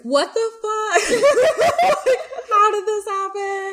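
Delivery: high, loud outburst breaking into laughter; retold disbelief. Host retells finding the story to friends, in laughing disbelief. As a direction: re-enact your stunned reaction, laughing through it. Retold shocked reaction to the list.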